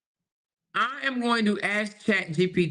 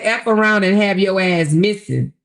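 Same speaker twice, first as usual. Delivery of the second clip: louder, punching the threat out; loud mock threat; 9 dB louder than usual. Reading the threat again to viewers, louder and mocking. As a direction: belt the quoted threat like she meant it. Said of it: "She said".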